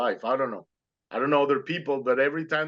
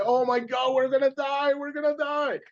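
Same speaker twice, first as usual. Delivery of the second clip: retold panic, much higher pitched; panicked scream; 12 semitones higher than usual. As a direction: squeal it like a terrified instructor in free fall. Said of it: "he started screaming at me".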